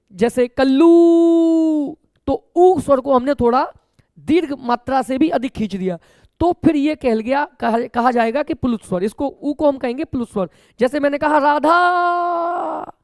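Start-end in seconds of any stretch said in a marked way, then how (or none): 0.5-2.0 high, loud, drawn-out call of Kullu with the ऊ stretched long. Shown as a demonstration. Teacher demonstrating a long vowel by calling out Kullu. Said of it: "जब हम किसी को पुकारते हैं". 11.4-12.9 Radha called high and slow, final आ held well past its length; stretched calling out. Shown as a demonstration. Teacher shows students the long vowel in Radha. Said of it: "आ स्वर को दो मात्रा तक उच्चारित करना चाहिए था".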